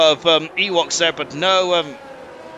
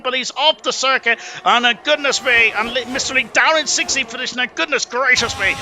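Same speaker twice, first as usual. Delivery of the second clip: higher pitched, rising play-by-play as cars swap places; excited commentary burst; 7 semitones higher than usual. Real, not acted. Commentator calling a flurry of overtakes to viewers, higher and excited. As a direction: get swept up in the overtakes, voice climbing.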